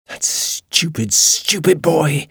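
high, slow, drawn-out annoyed hiss; hissed to herself. A narrator's reading. Ruby to herself as the man yells at Harry, hissed. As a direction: seethe privately, stretching the words out high. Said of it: "she hissed to herself".

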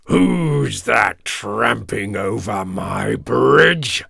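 slow, drawn-out troll roar; big slow ogre-like growl. A narrator's reading. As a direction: stretch the words out like a menacing troll. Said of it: "roared the troll".

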